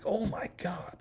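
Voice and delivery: high, hushed retold shock; startled gasp of words. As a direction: act out a small, stunned reaction. Retelling his startled reaction to demon.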